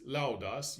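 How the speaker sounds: "laudas" stressed on lau; LAU-das, weight on the opening syllable of "laudas"; first-syllable stress. Shown as a demonstration. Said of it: "You will stress that first syllable".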